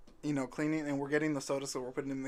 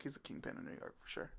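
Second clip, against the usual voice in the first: hushed whisper; 14 dB quieter, faster than usual. Retold or imitated. Private thought about the kid.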